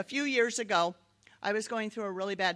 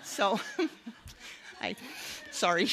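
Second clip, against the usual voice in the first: real laughter; 2 semitones higher than usual. Real, not acted. Laughs at her own story.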